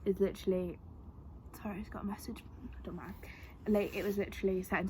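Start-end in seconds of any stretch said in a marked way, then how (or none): 1.6-3.1 real whispered aside. Real, not acted. Interrupted by a phone message, whispering an apology. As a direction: drop to a whisper mid-sentence.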